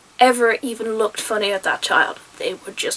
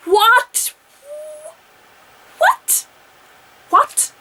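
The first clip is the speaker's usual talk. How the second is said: stunned what; much slower than usual. Real, not acted. Reacting to the face-stealing plan, stunned and high. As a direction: keep asking as if you misheard.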